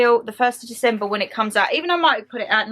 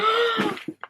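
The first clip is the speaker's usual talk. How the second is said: high gasp of happy surprise; delighted gasp; 10 semitones higher, 3 dB quieter than usual. Real, not acted. Delighted by the presents.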